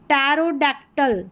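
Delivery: pterodactyl said slowly, starting on t; slow teaching pronunciation of pterodactyl, P silent. Shown as a demonstration. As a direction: stretch out pterodactyl as "tero-dactyl", no p. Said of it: "पी जो है वो silent है".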